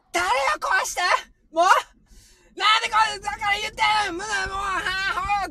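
high-pitched imitation of a child crying out in sleep; sudden panicked night-terror outburst, imitating daughter; 夜驚症の叫び真似. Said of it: "叫びをね". (甲高く泣き叫ぶ寝言の真似)

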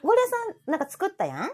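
ordinary talk, nothing marked

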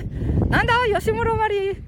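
very high puppet voice; high, cheeky character interjection; interrupting puppet. Pretend. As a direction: butt in as a squeaky little dummy.

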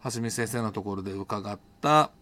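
normal talking voice

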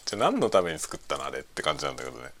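normal talking voice

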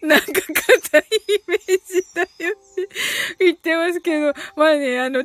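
ordinary talk

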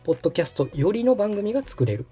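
ordinary delivery

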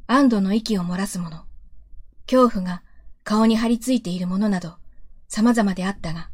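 plain reading voice

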